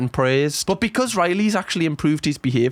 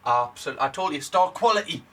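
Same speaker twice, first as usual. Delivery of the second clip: normal talking voice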